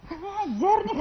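high, mock-outraged retort; indignant friend. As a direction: play the offended friend, half joking. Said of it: "kata si Ateke gitu". (tiruan protes pura-pura tersinggung, nada tinggi)

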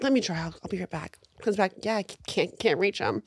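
soft, subdued phone-agent impression; imitated service agent. Agent reporting back to the customer, softly noncommittal. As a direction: drop the volume right down for the agent.

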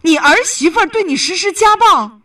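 real astonished question; shocked disbelief. Real, not acted. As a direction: ask it as if appalled by the news. Host shocked by reported abuse.

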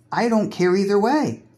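normal talking voice

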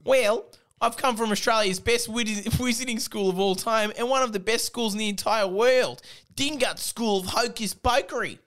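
high, proud mock Australian boast; Australian accent. Dylan boasts about his wizarding school in a mock Australian accent. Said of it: "said Dylan".

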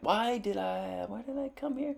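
high, very soft frightened whimper; scared whimper. Retold or imitated. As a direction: whimper it, spooked by a scream. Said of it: "And I went".

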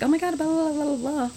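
high, quick run of babble; super fast. Shown as a demonstration. Showing how I talk super fast, quick and high. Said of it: "I talk, like, super fast".

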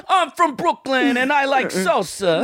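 high Brooklyn caricature; hammed-up Brooklyn tough guy; Brooklyn accent. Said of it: "everybody from Brooklyn sounds like Andrew Dice Clay".

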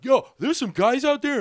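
high, loud complaint; whiny tattletale. Retold or imitated. Biker complaining to the bartender, high and loud. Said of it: "he went off to the bartender, like".

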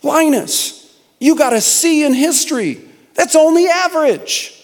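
high, scornful big-sister voice; scoffing Lucy. Acted. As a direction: scoff at him like a bossy sister. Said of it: "to which she scoffs".

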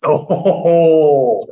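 ordinary talk, nothing marked

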